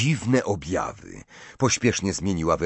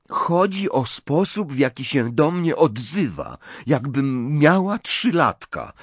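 Ginny, exasperated; 7 semitones higher, 4 dB louder than usual. A narrator's reading. Ginny complains of being treated like a toddler, exasperated. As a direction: draw out each grievance in a raised pitch.

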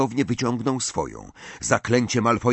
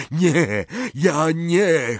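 high, louder panicked stammer; Harry denying what he did; stunned denial; 8 semitones higher, 4 dB louder, much slower than usual. A narrator's reading. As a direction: blurt it in disbelief. Harry horrified at wound.

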